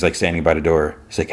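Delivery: usual speaking voice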